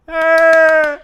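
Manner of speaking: sudden high loud shriek; scream. Real, not acted. Reacting to something on screen, a startled high scream.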